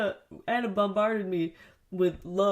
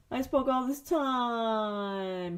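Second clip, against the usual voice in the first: slow, whiny, higher drawn-out complaint; 5 semitones higher, much slower than usual. Acted. Complaining to listeners about talking alone, whiny. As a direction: whine it like a sulky kid. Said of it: "that was so whiny".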